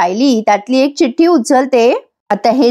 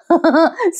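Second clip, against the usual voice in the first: a quick real laugh, pitched up; short high laugh; 6 semitones higher than usual. Real, not acted. Narrator laughing at the villain's joke, a short high laugh.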